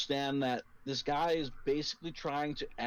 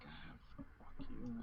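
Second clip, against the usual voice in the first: very quiet, unvoiced mumbled curse; barely audible muttering; murmured; 4 semitones higher, 14 dB quieter, slower than usual. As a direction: murmur it under your breath. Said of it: "some murmured".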